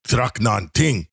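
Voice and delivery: slow, deliberate fake Klingon phrase; drawn-out Klingon line to woo a girl; mock Klingon pickup line. Student tries a Klingon pickup line on a girl, slow. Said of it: "They go".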